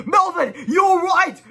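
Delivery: very high, loud, drawn-out cry; high slow joyful cry. Acted. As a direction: gush like a relieved mother. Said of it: "cried Missus Sneedley".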